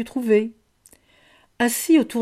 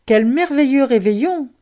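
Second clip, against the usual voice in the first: higher, louder burst of delight; delighted exclamation; 5 semitones higher, 6 dB louder than usual. A narrator's reading. Loupiote at the festive table, exclaiming happily. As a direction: exclaim it with wonder. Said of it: "s'exclama Loupiote entre deux bouchées".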